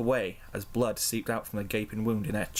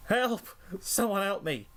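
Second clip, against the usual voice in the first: screaming for help; 10 semitones higher, slower than usual. A narrator's reading. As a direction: cry out in panic for rescue. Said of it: "flew out the door screaming".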